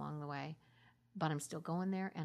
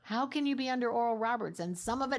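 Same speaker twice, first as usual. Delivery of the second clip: loud, fervent rhetorical question; almost shouting with conviction; emphatic exclaim; 4 semitones higher, 9 dB louder than usual. Real, not acted. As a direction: drive the question home with full force.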